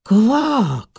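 high, loud croak of amazement; astonished cry. A narrator's reading. Frog sees a blue sky on the water, astonished cry. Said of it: "vykřikl podivením".

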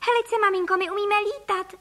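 put-on high-pitched chirpy boy; chirping. A narrator's reading. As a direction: chirp it proudly to mother, high. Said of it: "cvrlikal Filip". (cvrlikavý dětský hlásek)

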